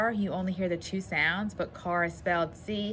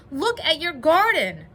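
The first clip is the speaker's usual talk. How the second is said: high, loud exclamation; excited call; 9 semitones higher, 8 dB louder than usual. A narrator's reading. Frog showing just-woken Toad his garden, excited call.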